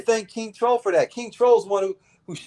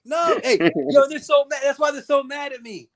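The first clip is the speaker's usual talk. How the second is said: excited gloating; 8 semitones higher than usual. Real, not acted. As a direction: crow over the haters, pitched way up. Excited gloating about haters.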